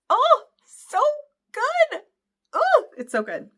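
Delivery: high, loud gushing squeal of delight; excited squeal. Real, not acted. Fan gushing to her viewers about the scene, squealing with delight. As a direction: shriek with joy over how good it is.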